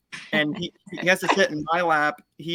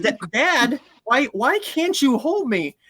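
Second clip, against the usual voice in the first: higher, pleading pet voice; dog's voice; 7 semitones higher than usual. Retold or imitated. Big dog begging dad to carry him, higher and whiny. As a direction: whine it like a big dog wanting to be carried. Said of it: "he says".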